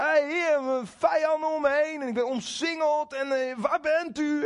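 high, pleading cry to the Lord; David's tirade. Retold or imitated. David surrounded by enemies cries out to God, high and pleading. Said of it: "dan begint hij met zijn tirade".

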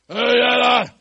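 loud, high soldier's shout; tough soldier. Acted. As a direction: challenge him like a burly guard. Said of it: "een hele stoere soldaat".

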